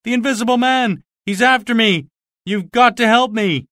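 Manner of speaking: high, loud, frantic begging; terrified plea. Acted. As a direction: plead for your life, breathless. Terrified Marvel begs for help.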